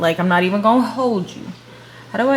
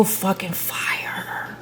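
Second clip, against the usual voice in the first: whispering; 7 dB quieter, much slower than usual. Real, not acted. Awed aside.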